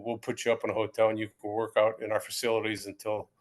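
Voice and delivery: low, even recruiter's spiel; imitated official running through routine details; Gil Brandt. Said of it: "it was a whole spiel".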